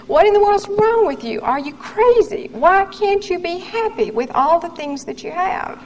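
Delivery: high, exasperated questioning of herself; self-scolding. Retold or imitated. Woman asking herself why she isn't happy, exasperated. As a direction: demand an answer from yourself, baffled.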